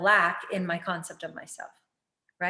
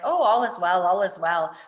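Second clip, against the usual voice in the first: louder, breezy fake reassurance; mock denial; 7 dB louder than usual. Pretending all is well while in denial, loud and breezy. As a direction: brush it off with forced cheer. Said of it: "trying to ignore it and be in denial".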